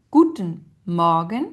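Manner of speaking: slow "Guten Morgen", GU- and MOR- stressed; first-syllable stress. Shown as a demonstration. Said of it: "un accent sur la première syllabe".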